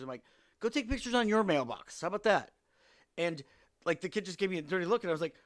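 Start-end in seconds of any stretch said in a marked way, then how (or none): 0.6-2.5 sarcastic retort. Retold or imitated. Scolding kids on her mailbox.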